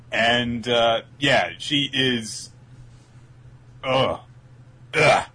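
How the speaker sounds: ordinary talk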